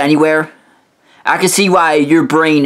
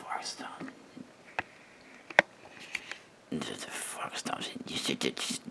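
mocking whisper; 25 dB quieter than usual. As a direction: hiss the nickname back at him in a whisper. Mocking the friend's sign-off name.

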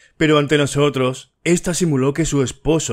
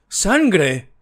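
higher, shrieked in horror; hysterical cry; 7 semitones higher than usual. A narrator's reading. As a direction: cry out at the sight of blood. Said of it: "exclamó con voz histérica".

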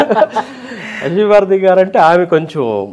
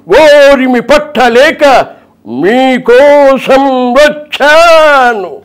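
loud, slow, grand political oration; NTR; 8 semitones higher, 7 dB louder, slower than usual. Shown as a demonstration. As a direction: declaim every line like a stage hero. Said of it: "NTR గారి voice".

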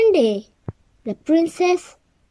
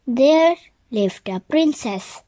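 ordinary delivery

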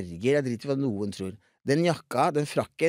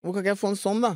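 higher-pitched retold begging; pleading child; 6 semitones higher, faster than usual. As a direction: plead with dad, pitch raised. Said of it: "Og så sier jeg".

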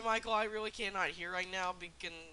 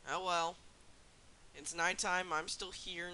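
ordinary talk

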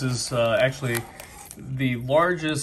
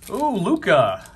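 excited; 7 semitones higher, 5 dB louder than usual. Real, not acted. Pulls a star card.

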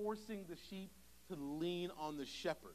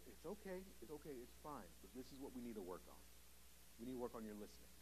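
softer, gentle comfort to a hurt son; reassuring father; 8 semitones lower, 11 dB quieter, faster than usual. Retold or imitated. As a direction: soothe him, then softly correct.

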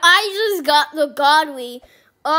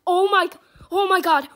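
ordinary talk, nothing marked

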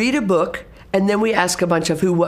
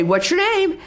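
high, sing-song quizzing teacher voice; testing voice; 11 semitones higher than usual. Shown as a demonstration. Teacher quizzing a student she knows, testing voice. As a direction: quiz them like you already know. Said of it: "in that testing voice".

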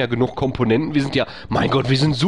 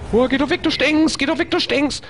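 high-pitched, desperate shooing; panisches Abwehren; 12 semitones higher than usual. Real, not acted. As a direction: push it away with a strained, squeaky voice. Still fending off game enemy. (verzweifelt und hoch wegscheuchen)

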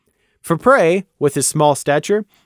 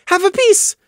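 much higher, bright command; cheery order; 5 dB louder than usual. Acted. Taffy orders Prey to eat, high and cheery. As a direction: insist cheerfully. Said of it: "she ordered cheerily".

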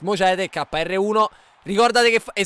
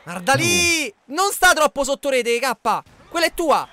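shouting; 5 semitones higher, 5 dB louder, slower than usual. Real, not acted. Shouting directions mid-game.

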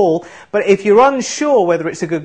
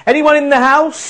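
calling out; 9 semitones higher, 4 dB louder than usual. Real, not acted. Calling out to the studio.

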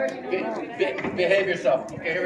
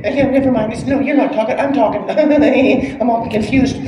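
higher-pitched, muddled stand-in voice; dubbed volunteer voice; 3 semitones higher, 6 dB louder than usual. Pretend. Comedian voicing a volunteer who must only move, put-on higher voice. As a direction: speak for the confused volunteer, pitched up. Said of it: "I'm the voice, you're the movement".

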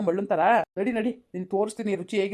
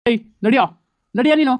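loud, angry command to move; officer shouting at the arrested husband; loud order; 2 semitones higher, 8 dB louder than usual. Acted. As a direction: bark him out of the room.

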